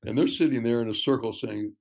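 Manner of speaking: ordinary talk, nothing marked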